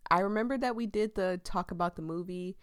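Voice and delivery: ordinary talk, nothing marked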